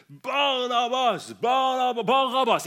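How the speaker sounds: loud, high mob chant, first word drawn out; high-pitched insistent yell; crowd shouting. Retold or imitated. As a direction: roar it like a whole crowd demanding a prisoner. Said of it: "begynner hele folkemengden å rope".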